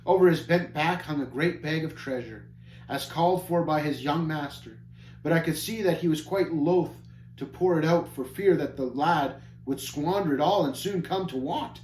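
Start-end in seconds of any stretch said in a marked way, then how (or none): none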